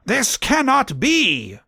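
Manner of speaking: high, drawn-out theatrical outrage; angry shout. A narrator's reading. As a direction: cry it out slowly, pitch way up. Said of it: "she shouted angrily".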